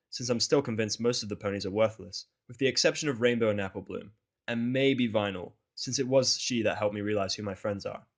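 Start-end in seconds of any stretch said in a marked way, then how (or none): none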